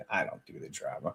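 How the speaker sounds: whispered aside. Real, not acted.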